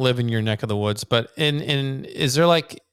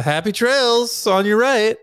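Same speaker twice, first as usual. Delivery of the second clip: cheery greeting; 8 semitones higher, 4 dB louder, slower than usual. Shown as a demonstration. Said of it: "I'd say".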